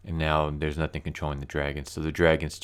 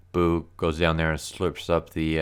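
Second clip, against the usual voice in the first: normal talking voice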